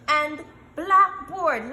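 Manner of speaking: slow, loud "And blackboard." split into beats; clapped syllables. Shown as a demonstration. Teacher modelling word parts for clapping. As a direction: stretch it out for clapping. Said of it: "Let us clap".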